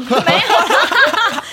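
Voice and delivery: very slow, high laughing denial. Real, not acted. (大笑着否认)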